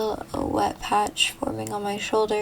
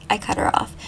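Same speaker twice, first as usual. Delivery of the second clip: usual speaking voice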